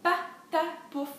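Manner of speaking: "patapouf" slowly in three separate beats; syllable-by-syllable. Shown as a demonstration. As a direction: break "patapouf" into pa, ta, pouf. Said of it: "Trois syllabes".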